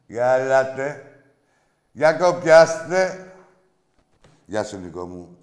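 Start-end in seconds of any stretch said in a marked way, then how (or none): 0.1-3.3 drawn-out, louder welcoming call; slow, loud host's summons. Real, not acted. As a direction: stretch it out to invite people in. Host calling in the callers.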